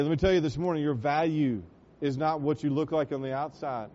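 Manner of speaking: ordinary talk, nothing marked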